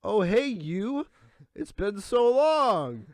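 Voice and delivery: fake-friendly bluff. Waiter pretends to remember a customer, slow fake cheer. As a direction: pretend to know her, stretching every word. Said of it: "I pretend to know who they are".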